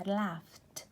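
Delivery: "laughed" said slowly with a clear final t sound; slow English demo. Shown as a demonstration. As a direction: model "laughed" slowly for the class. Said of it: "diría".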